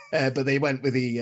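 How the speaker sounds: ordinary talk, nothing marked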